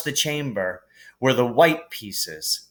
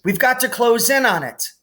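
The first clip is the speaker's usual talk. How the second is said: raised pitch, calling across the room; urgent raised call; 12 semitones higher, 3 dB louder than usual. A narrator's reading. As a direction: call it out to friends on brooms. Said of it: "Harry called".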